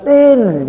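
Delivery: high "tin" like a beeping horn; car horn imitation. Preacher imitates teachers honking instead of greeting. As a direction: honk the horn with your voice. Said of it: "Asal jumpa Ustaz".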